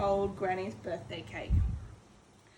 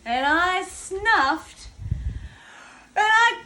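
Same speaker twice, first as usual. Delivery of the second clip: slow, drawn out and building louder and higher; building huff to sneeze; 4 semitones higher, 6 dB louder, much slower than usual. Acted. Wolf winding up a sneeze at the straw house, slow and swelling. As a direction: stretch each word and swell into the sneeze.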